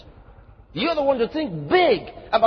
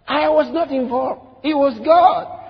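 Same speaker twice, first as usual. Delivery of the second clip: higher, louder mock-pious disclaimer; mock humility; 5 semitones higher, 5 dB louder than usual. Mocking Christians who deflect credit, hammed-up. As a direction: overdo the false modesty.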